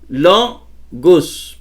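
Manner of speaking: "Logus" broken in two, stress on "Lo"; penultimate stress. Shown as a demonstration. Teacher drilling the accent, "Logus" split with stressed LO. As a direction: hit the LO of "Logus", pause, then "gus". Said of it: "penúltima".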